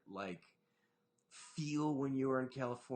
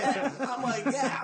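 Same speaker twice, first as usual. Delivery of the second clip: high, loud laughing yeah; laughing while retelling; 10 semitones higher, 12 dB louder, slower than usual. Real, not acted. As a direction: laugh through the words.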